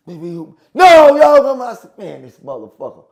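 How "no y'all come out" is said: loud, high, stretched-out holler; mock guard hollering across the yard; calling out. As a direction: shout it slow and high. Retold guard calling them off.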